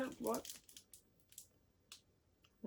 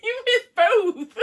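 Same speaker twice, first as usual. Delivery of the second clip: slow high laughing; 9 semitones higher, 7 dB louder, much slower than usual. Real, not acted. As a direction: laugh it out slowly. Throwing sweets game.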